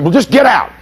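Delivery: high-pitched, exasperated order; desperate dismissal. Retold or imitated. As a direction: blurt it as the chief at wits' end. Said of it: "finally got desperate".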